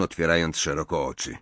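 usual speaking voice

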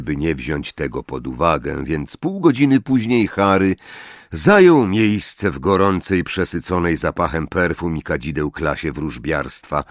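plain reading voice

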